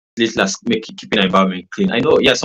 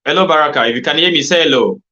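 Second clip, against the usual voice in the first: raised voice, calling across a bad line; calling out loudly; 7 semitones higher, 4 dB louder than usual. Real, not acted. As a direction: call out to someone who can't hear.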